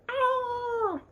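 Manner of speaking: high piercing gull squawk; screechy seagull voice. Acted. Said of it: "seagull impression".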